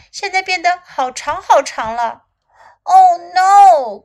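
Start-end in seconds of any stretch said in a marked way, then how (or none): 2.8-4.0 loud, higher storybook wail; crying out. A narrator's reading. Little elephant upset about its long nose, crying out. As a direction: wail it out in dismay. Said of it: "cried little elephant".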